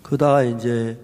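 ordinary talk

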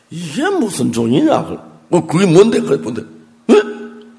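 loud, high, incredulous questions; retold astonished questioning. Husband questioning his wife about the receipt, astonished. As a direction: build to a high, quick, baffled demand.

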